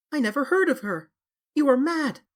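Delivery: stammered. A narrator's reading. Dorian stammering his denial to James Vane. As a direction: stumble out the denial in fear.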